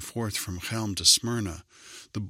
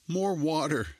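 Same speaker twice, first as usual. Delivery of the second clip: high urgent call; girl shouts for water at the door; shouting; 7 semitones higher than usual. A narrator's reading. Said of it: "she shouted".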